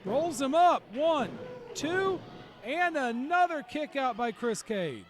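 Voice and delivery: loud, rising count at the near fall; excited play-by-play. Real, not acted. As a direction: call the pin count with excitement.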